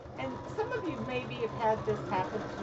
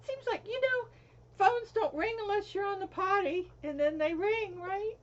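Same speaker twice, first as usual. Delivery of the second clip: amused exaggeration; 11 semitones higher, 4 dB louder than usual. Real, not acted. Joking complaint about phones.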